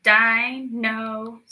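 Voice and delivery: Dinosaur slowed into separate counted beats; syllables counted out. Shown as a demonstration. Teacher counting syllables aloud with the class. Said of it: "Let's count it".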